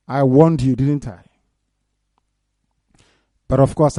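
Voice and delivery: ordinary delivery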